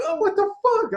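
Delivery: sudden high-pitched disbelief; shocked outburst. Retold or imitated. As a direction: yelp it in outrage. Discovers bouncer kept ID.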